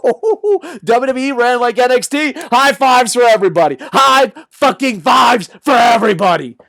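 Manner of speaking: loud, high cheering; excited shout. Real, not acted. Host celebrating an NXT-style WWE, loud and high. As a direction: yell it like a party.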